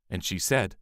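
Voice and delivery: ordinary delivery, nothing marked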